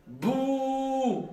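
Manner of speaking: drawn-out long "Bou" with a held oo. Shown as a demonstration. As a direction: stretch "Bou" into a long vowel. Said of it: "je prends la damma ... je la colle".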